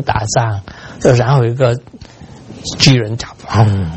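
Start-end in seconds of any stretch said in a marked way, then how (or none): none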